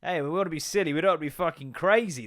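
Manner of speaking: ordinary delivery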